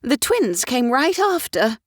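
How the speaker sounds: high, excitable lady's voice; fussy society mother. A narrator's reading. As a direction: chatter like a flighty mother of the ton.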